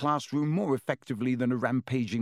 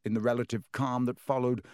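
ordinary delivery, nothing marked

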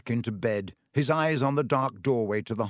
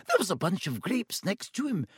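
higher, crisp Scottish-tinged witch voice; stern professor; 8 semitones higher than usual. A narrator's reading. McGonagall telling Dumbledore, worried. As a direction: report it like a worried teacher.

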